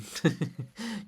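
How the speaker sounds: high rising amused laugh; teasing laugh. Acted. Libet laughing at Olha flaring up, a high teasing laugh. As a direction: laugh at her temper.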